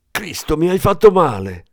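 high, loud cry of pain; pained exclamation. Acted. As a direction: blurt it out hurting and loud. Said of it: "esclamò il detective". (esclamazione acuta e forte di dolore)

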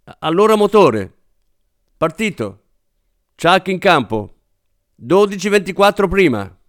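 film-set calls. A narrator's reading. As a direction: call the take out to the crew. Film crew calls before a take. (richiami da set, voce alta)

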